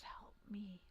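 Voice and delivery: weak, hushed plea in a whisper; quiet whisper. Acted. Trapped elven woman reaches out to the party, whispering very quietly. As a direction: whisper it very softly, barely any voice. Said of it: "just very quietly whispers".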